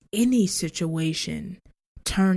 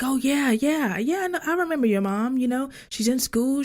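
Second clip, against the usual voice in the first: eager news guy; 7 semitones higher than usual. Retold or imitated. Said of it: "he was like".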